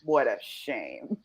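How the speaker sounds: low, soft, slow tutting; hushed, drawn-out mock sympathy; mock pity. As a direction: sigh it like an idle onlooker. Imagined TV viewer reacting at home.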